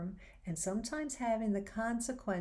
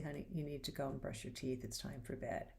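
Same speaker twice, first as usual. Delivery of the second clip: soft, kind reminder, much quieter; said nicely; 5 semitones lower, 7 dB quieter than usual. Retold or imitated. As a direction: gently tell the child it's bedtime. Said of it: "and say nicely".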